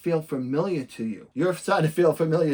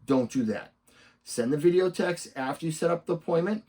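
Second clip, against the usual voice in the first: ordinary talk, nothing marked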